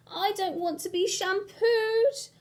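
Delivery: put-on high, loud, slow wailing child. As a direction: wail it like a baby in the bath. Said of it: "wailed the baby in the bubbles".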